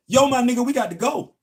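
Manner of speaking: high, loud casual call; imitating coworker. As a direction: mimic his buddy calling out easy and loud. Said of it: "That's how he said it".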